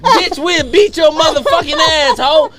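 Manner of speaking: high, slow mock yell out a car window; hammed-up threat, dragged out and high; cousin's threat. Cousin yelling threats from the car window, high mock yell. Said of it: "her cousin hanging out the window like this".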